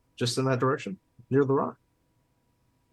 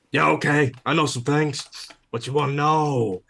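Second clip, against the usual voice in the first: higher, louder bird character voice; cheeky seagull informant; 5 semitones higher, 3 dB louder than usual. Acted. Seagull offering information to the player, cheeky bird voice. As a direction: agree to trade gossip like a cocky gull. Game master plays the seagull.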